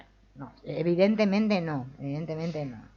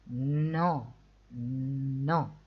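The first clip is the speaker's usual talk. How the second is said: ordinary delivery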